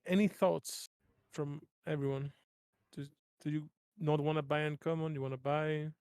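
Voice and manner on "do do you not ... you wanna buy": ordinary delivery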